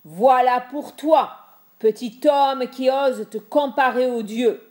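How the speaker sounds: furious god roaring from the sky; thundering voice. Acted. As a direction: hurl the words down like thunder. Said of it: "cria d'une voix de tonnerre".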